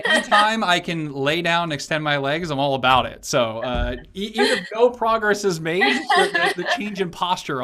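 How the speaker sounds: chuckling through the words; laughing while talking. Real, not acted. Laughing at couch joke.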